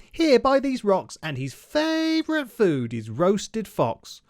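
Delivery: high, loud, then slowing on the punchline; spring the trap on the fox; teasing mouse. Acted. Mouse telling the fox about roasted fox, high and teasing.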